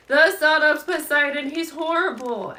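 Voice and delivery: high, loud, horrified shriek-like cry; terrified cry. Acted. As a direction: shriek it in terror, pitch and volume way up. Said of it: "strangled, terrified sound".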